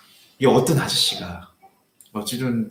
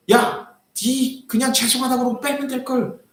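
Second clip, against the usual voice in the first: a hint of a grumpy elder, higher; lightly voiced scolding old man; 10 semitones higher than usual. Old man telling the young driver to just apologize, lightly voiced.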